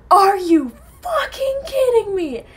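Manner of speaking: high, loud, slow-stretched disbelief; outraged yell. Retold or imitated. Stranded driver learns it's cow manure, outraged yell. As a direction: re-enact an exasperated, wailing outburst.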